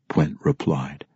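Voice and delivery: ordinary talk, nothing marked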